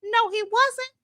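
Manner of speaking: high sarcastic mock gasp; mock outrage. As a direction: scoff at him in a high voice. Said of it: "I said".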